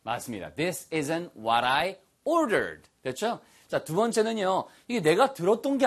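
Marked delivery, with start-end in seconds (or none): 0.5-2.9 very slow, every word clear; this isn't what I ordered, drawn out for repeating; slow model sentence. Shown as a demonstration. Teacher modelling the sentence slowly for learners. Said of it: "your turn".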